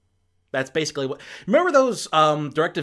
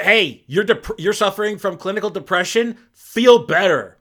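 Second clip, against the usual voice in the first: louder, higher mock tough-guy advice; mock gruff advice; 7 semitones higher, 8 dB louder than usual. Reviewer mocking the mentor's advice, louder and higher. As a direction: bark it like a dismissive mentor, half-joking. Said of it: "Harrison Ford essentially telling Patton Oswalt".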